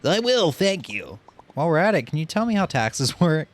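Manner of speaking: playful character voice. Pretend. Voicing the crab character.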